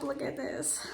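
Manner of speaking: whispered, soft show-and-tell; hushed whisper. Real, not acted. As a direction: whisper it like a secret treasure. Showing cute baby clothes.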